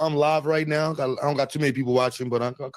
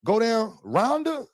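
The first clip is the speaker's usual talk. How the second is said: put-on country accent; 7 semitones higher, much slower than usual. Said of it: "He said".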